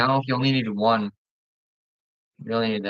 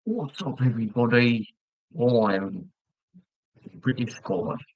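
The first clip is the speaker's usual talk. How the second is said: drawn-out mock British greeting; British accent put on for fun; slower than usual. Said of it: "can you do any other accents".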